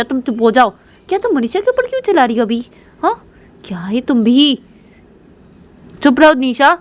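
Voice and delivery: high, exasperated telling-off; scolding. Acted. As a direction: scold an unruly daughter. Mother scolds daughter to be quiet.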